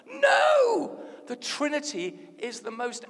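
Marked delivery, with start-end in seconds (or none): 0.1-1.0 loud, high shout of refusal; drawn-out, high-pitched cry of rejection; emphatic shouted no. Real, not acted. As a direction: shout down the silly idea. Emphatic rejection of mock answer.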